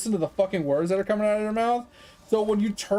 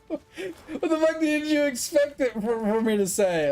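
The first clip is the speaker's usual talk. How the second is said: exasperated outburst; 9 semitones higher than usual. Real, not acted. Exasperated rant at the customer.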